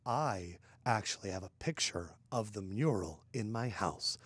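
lightly voiced old man, low and soft; slightly low, quiet old-timer delivery; a hint of a soft-spoken old man; old Jimmy Goldstein. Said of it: "And he goes".

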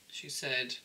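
ordinary talk

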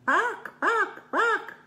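very high, loud yappy barks; high fox yapping. Acted. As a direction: yap like a little fox. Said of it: "a fox going".

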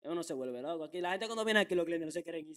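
ordinary talk, nothing marked

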